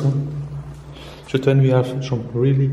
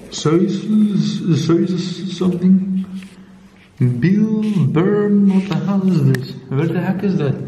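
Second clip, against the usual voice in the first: surprised; 5 semitones higher, 3 dB louder, slower than usual. Real, not acted. Finds strange papers in house.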